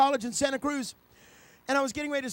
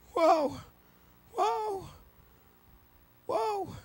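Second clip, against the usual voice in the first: awed cry; 8 semitones higher, much slower than usual. Acted. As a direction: let each whoa die away in awe. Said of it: "He suddenly says".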